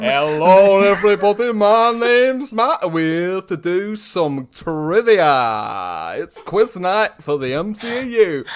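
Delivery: loud, high, stretched-out camp quiz host; holiday rep. Pretend. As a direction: belt out a welcome to quiz night. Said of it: "holiday repping in Skegness Butlins".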